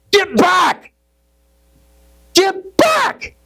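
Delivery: high, panicked yell of alarm; terrified shout. Acted. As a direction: shriek it as the voice of terror. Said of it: "this voice of terror in me, excuse me, shouts".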